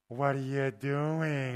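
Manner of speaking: loud, drawn-out eerie call; creepy monk. Retold or imitated. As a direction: stretch it out to spook someone at the window. Acts out monk at window.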